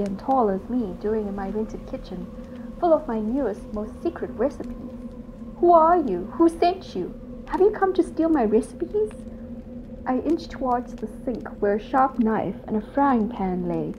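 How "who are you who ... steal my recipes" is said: high, loud startled demands; alarmed questions. Acted. Cook startled by a stranger in her kitchen, high and alarmed. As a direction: fire questions at the intruder in alarm.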